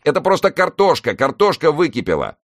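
insistent, high-pitched protest; exasperated. Acted. Newson explaining potatoes to the operator, exasperated. Argues with fire service on phone.

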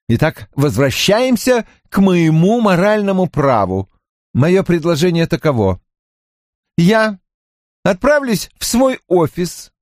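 lightly voiced earnest businessman; a hint of a pompous dealmaker; insistent businessman. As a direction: make your proposal with mild insistence. O'Neill pleads his moral right.

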